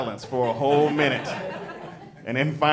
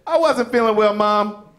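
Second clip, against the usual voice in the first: higher, louder swagger; tough little boy; 9 semitones higher, 4 dB louder than usual. Retold or imitated. Little boy to his mother, mock tough raised voice. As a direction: kid trying to sound tough.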